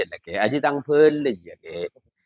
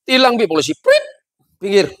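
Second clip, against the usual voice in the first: police whistle stop; 7 semitones higher, faster than usual. Acted.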